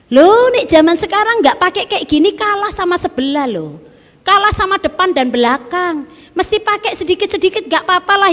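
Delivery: high, loud voice of a careless merchant; mocking shopkeeper. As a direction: play the shopkeeper justifying his charm. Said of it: "Orang Kristen loh itu".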